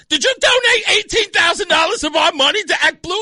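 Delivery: high, loud mock accusation; outraged wife. Retold wife questioning husband about missing money, shouting in outrage.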